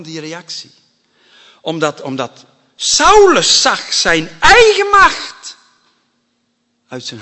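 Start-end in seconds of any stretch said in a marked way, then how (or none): 1.6-5.6 loud, high, slow preaching burst; preaching at full force. Real, not acted. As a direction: hammer the point home, raised voice. (luid en hoog preken)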